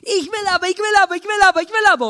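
put-on high, loud whiny child yelling; screaming child. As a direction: demand like a tantrum kid at the checkout. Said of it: "fängt das Kind an zu schreien".

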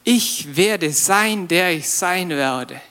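ich werde sein drawn out slowly; slow and deliberate. Shown as a demonstration. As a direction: spell it out word by word. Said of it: "bedeutet noch viel mehr". (langsam und betont: ich werde sein)

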